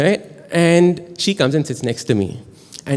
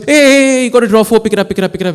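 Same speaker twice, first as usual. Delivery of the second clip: high, loud heckling from the whole table; excited calling out; 9 semitones higher, 6 dB louder, much faster than usual. Retold or imitated. Card players spotting his dropped draw four, loud mock clamour. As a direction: pounce on the slip, loud and high.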